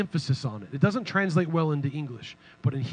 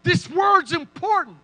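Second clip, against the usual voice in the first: much higher, loud, slow call for attention; Moses highlighting; 9 dB louder, slower than usual. Pretend. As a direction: wave the reader over to the key word. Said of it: "exposes it like".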